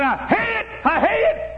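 very high hollering imitation of a preacher; imitated screaming. Youngster imitating the son's sermon, screaming holler. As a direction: mimic a frenzied pulpit holler. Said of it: "he just screamed and hollered".

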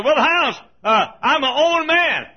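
high, loud mimicked protest; mock defiant outburst; defiant objector. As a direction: protest like someone asserting independence.

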